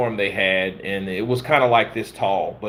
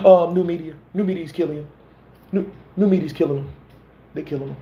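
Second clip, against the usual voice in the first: lightly higher, hyped streamer boast; a light impression of a hyped boxing streamer; Ego impression; 4 semitones higher, faster than usual. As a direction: brag and roast like a boxing streamer. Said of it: "Do a boxing ego impression".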